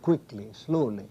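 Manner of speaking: "quickly, slowly", slow, with first syllables stressed; stress on the root. Shown as a demonstration. Poet repeating English adverbs to show the stress, stress on the root. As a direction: draw out QUICK-ly, SLOW-ly, endings falling away. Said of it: "stress falls on the significant part".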